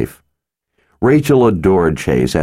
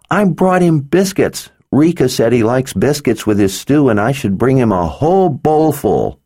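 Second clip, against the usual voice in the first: put-on bright small girl, matter of fact; little girl voice; 9 semitones higher than usual. A narrator's reading. Narrator voices Rachel.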